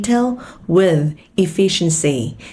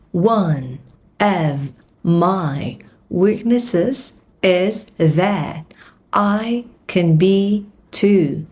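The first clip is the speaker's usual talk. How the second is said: very slow, word by word; much slower than usual. Shown as a demonstration. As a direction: drill it slowly. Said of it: "slow drill".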